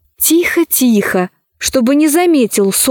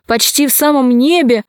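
usual speaking voice

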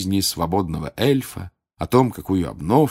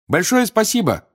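loud, high thanks to a crowd; calling out; 13 semitones higher, 5 dB louder than usual. A narrator's reading. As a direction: call your thanks across the room. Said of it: "крикнул Гарри эльфам".

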